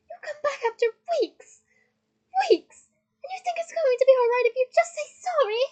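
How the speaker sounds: high, loud, incredulous fury; outraged tirade. A narrator's reading. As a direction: rage at the feeble apology. Hermione raging on at Ron.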